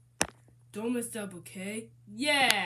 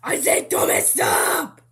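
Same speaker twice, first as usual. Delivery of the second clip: harsh strained yell; pretend shout; 5 semitones higher, 12 dB louder than usual. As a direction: bark it out at full volume. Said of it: "Why you yelling at me like that?".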